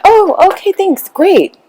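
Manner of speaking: high, flustered "oh okay" from a stunned mom; stunned polite reply. Retold or imitated. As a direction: caught off guard, forcing politeness. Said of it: "she's like".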